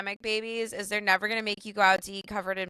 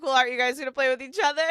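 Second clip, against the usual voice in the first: fast, slightly higher deflection; rushed retort; 4 semitones higher, much faster than usual. Retold or imitated. Mom to kids at stairs, fast mock retort. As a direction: rattle it off quickly. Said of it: "I'm like".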